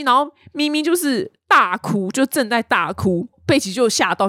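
ordinary delivery

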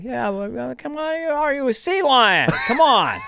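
Biden impression. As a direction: do Joe Biden pitching a pickup line. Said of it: "But Biden be like".

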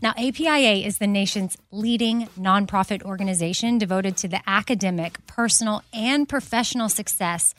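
upbeat sponsor read, quick and bright; host reading a Macy's sponsor spot. Acted. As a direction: sell it like a podcast ad.

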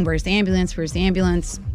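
ordinary delivery

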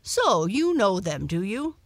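lightly voiced dog, loud and keen; eager dog, swooping question; inquisitive dog. A narrator's reading. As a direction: bark the question with interest.

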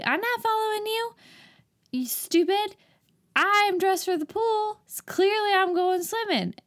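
high-pitched mock protest; mock indignant denial. Voices film man's indignant denial.

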